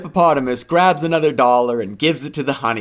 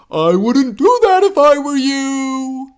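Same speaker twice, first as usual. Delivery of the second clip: high, slow louder warning; 12 semitones higher, 5 dB louder than usual. A narrator's reading. Panda warning hippo, slow high voice. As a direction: caution her from afar. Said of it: "she hears".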